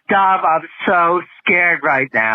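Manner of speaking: high, slow, overacted fear; quoting a scared movie line, hammed up; mock scared. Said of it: "you will often regale us with".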